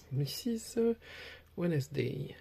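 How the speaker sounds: card name in an attempted English accent; self-mocked English accent. Real, not acted. Card opener reading out a card, attempted English accent. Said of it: "Avec mon super accent anglais".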